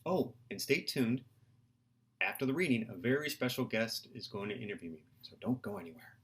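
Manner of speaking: plain reading voice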